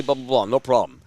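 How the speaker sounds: ordinary talk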